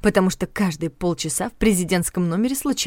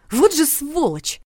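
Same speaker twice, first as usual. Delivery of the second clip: drawn-out angry exclamation, higher; slow indignant curse; 8 semitones higher, slower than usual. Acted. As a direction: stretch it out in outrage. Heroine fumes at her boss.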